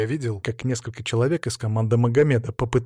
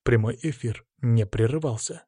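usual speaking voice

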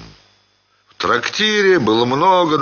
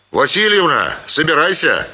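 higher-pitched summons; calling out; 6 semitones higher than usual. A narrator's reading. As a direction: hail her to get ready. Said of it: "окликнул Семён". (оклик возницы)

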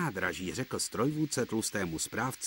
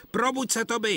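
high, louder wake-up call; 13 semitones higher, 4 dB louder than usual. A narrator's reading. Driver calls to wake up Toby, high and loud. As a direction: rouse the sleeping engine with a call. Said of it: "křičel".

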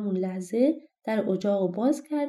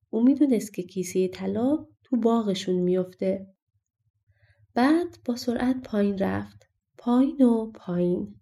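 plain reading voice

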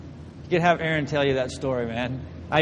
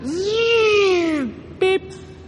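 high buzzing tone, then a beep; imitated flatline; 14 semitones higher than usual. Preacher mimics monitor before blacking out, high buzz.

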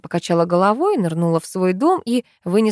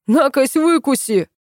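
high-pitched taunt called from afar; defiant call back; 6 semitones higher, slower than usual. A narrator's reading. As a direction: throw the refusal back across the yard. Neighbour shouts back a refusal. (задиристый выкрик издалека)

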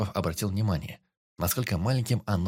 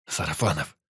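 ordinary delivery

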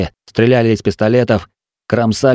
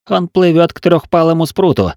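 high-pitched battle report; urgent report; 8 semitones higher than usual. A narrator's reading. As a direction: call out the sighting to the captain. Lookout reports monster's course.

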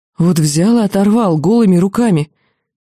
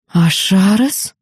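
slow, disbelieving call; can it really be him; incredulous; much slower than usual. Acted. Mentor recognising the old demon friend, slow incredulous question. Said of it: "раздался недоверчивый голос".

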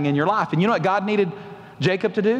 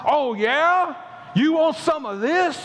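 higher, slower, cocky taunting voice; sassy challenge; 7 semitones higher, much slower than usual. Retold or imitated. Scared Christians picking a fight, a mock-tough sassy taunt. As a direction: square up with a smirk, daring them. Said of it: "We get sassy".

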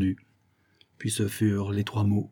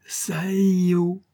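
high, drawn-out recorded cry; high sustained wail from a machine; phonograph replaying a cry; 7 semitones higher than usual. A narrator's reading. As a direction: stretch it out like a slowed phonograph cry. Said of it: "le phonographe de cri à son tour".

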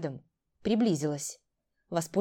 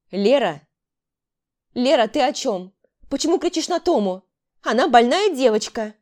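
loud, raised-pitch motherly plea; pleading; 5 semitones higher, 7 dB louder than usual. A narrator's reading. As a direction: plead urgently for the girl. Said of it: "Взмолилась мать". (умоляюще)

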